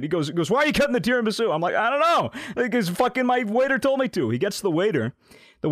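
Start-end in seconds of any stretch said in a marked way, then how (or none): 0.5-1.5 high, rapid-fire imitation of the boss; retold angry yelling. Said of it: "he's yelling at me". 1.8-4.3 high-pitched, exasperated excuse; retold flustered defence. Retold defence to yelling manager.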